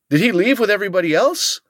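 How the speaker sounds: worried surprise. Retold or imitated. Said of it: "He's like".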